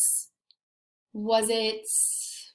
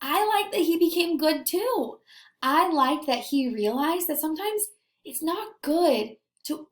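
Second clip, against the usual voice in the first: higher, bright encouraging teacher tone; teacher voice to kids; 5 semitones higher than usual. Acted. Teacher talking to young scholars, bright and cheery. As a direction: sound delighted for the class.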